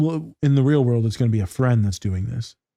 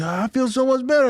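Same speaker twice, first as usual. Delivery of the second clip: much higher relieved client; 12 semitones higher than usual. Retold or imitated. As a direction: chirp it like the happy client. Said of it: "I said".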